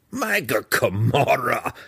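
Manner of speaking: drawn-out, exasperated oath; disbelieving exclamation. A narrator's reading. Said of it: "Belwar mumbled".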